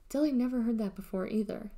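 usual speaking voice

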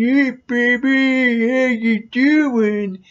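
slow, drawn-out come-on. Retold or imitated. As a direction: lay on a stretched, cheesy pickup line.

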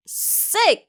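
"sick" with a hard, tense opening S, like Korean ㅆ; strong initial S. Shown as a demonstration. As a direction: lean hard on the S that starts "sick". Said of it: "이렇게 강하게 발음하세요". ("sick"의 S를 쌍시옷처럼 강하게)

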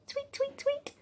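very high, soft birdlike chirps; bird tweeting. Shown as a demonstration.

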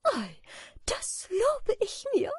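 very high, delighted character voice; joyful calling. A narrator's reading. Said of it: "rief freudig Amanda". (freudig gerufen, sehr hohe Stimme)

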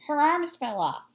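put-on loud, high kid's shout. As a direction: yell it like a startled kid.

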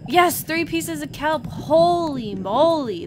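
loud, slow excited exclamation; triumphant. Real, not acted. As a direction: celebrate a haul, drawing the words out. Harvest succeeds.

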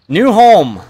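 high, very loud happy shout; excited cry. Real, not acted. Gamer cheers at the new base, loud and high. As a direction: burst out in glee at the new base.

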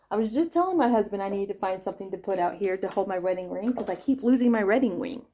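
usual speaking voice

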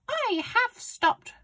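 very high, slow granny voice; grandma answering. A narrator's reading. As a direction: answer back as Grandma, drawn out. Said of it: "she answered".

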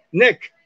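NECK closed with a k sound. Shown as a demonstration. Teacher showing final G seems like K, hard-ended "neck". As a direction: finish neck as if spelt with K. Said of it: "que yo estoy diciendo con la K".

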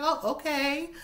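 the wife answering the husband in a high mock voice; high mock reply. Voicing her reply.

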